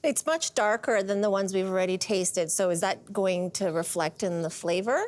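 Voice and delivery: ordinary delivery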